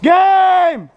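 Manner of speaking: very high, loud exclamation; shout. Real, not acted. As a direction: yell it out at the end. Match ends.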